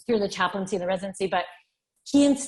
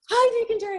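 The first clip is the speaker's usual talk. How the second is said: much higher, bright eager hello; excited greeting; 13 semitones higher, faster than usual. Retold or imitated. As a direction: greet him like you've waited all night for this call. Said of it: "And I was like".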